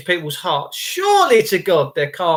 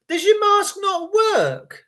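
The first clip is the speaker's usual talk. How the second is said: mocking high question; 10 semitones higher, slower than usual. Real, not acted.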